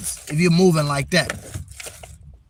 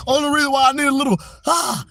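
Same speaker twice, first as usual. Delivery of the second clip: high, loud, rapid burst; amped-up coffee energy; hyped up; 9 semitones higher, 4 dB louder than usual. Acted. Hyping up his coffee energy.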